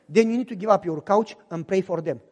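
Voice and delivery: wife calming her fuming husband, low and firm; wife's calming voice. Retold or imitated.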